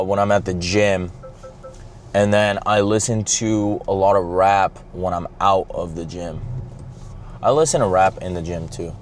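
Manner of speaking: ordinary talk, nothing marked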